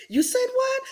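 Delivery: high-pitched schoolmate's question, lightly acted; mock disbelief. Kids asking her to repeat the insult, mock disbelief. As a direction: pitch it way up as if astonished.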